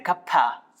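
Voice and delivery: खप्पा with a breathy kh, said as a joke; joking mispronunciation. Shown as a demonstration. Teacher jokingly mispronounces कप्पा for students. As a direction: say खप्पा instead of कप्पा, teasing. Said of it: "क्या बोलते हैं?".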